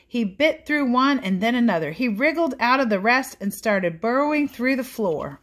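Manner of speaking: put-on lively read-aloud for children; animated picture book narration; storybook reading. A narrator's reading. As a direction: read it like bedtime story time.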